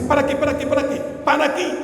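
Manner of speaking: quick, insistent retold command; urgent repeated order. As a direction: rattle out the order to halt.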